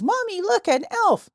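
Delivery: put-on high, excited little girl voice. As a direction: squeal it like a small kid. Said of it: "a little girl says".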